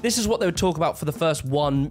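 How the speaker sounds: usual speaking voice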